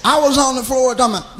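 ordinary talk

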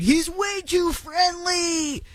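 high, slow mocking whine; mocking critics. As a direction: parody the outraged critics. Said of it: "the immediate response from people is like".

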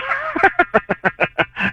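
high, rapid mock cackle; put-on high-pitched laughter. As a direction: imitate a taunting voice laughing. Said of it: "The voice goes".

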